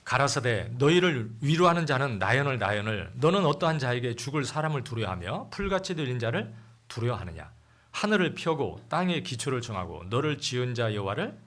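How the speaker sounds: loud, solemn Bible reading; scripture reading. Acted. Pastor reading the storm passage aloud, solemn and loud. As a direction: proclaim the verses to the congregation.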